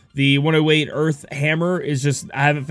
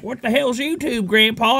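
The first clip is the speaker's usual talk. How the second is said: higher-pitched mock grandchild voice; imitated grandkids; 6 semitones higher than usual. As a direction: lightly play a puzzled grandkid asking Grandpa. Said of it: "when my grandkids are like".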